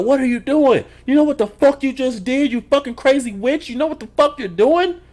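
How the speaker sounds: put-on high, loud yelling at the girl; hammed-up furious yell; mock yelling. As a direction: scream at her for what she just did. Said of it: "starts yelling at the girl".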